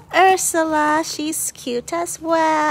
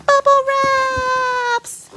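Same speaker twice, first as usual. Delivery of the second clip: excited; 9 semitones higher, 5 dB louder, much slower than usual. Real, not acted. Delighted at bubble wrap while unboxing.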